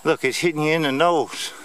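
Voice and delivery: ordinary delivery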